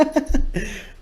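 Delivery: high breathless giggle; own laugh retold. Showing the host how he laughed off Toker's offer, a high airy giggle. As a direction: laugh it off lightly. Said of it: "I just laughed".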